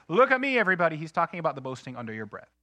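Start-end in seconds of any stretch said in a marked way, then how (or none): 0.1-1.0 loud, high show-off cry; show-off boast. Retold or imitated. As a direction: crow it like a braggart to the room. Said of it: "boasting that says".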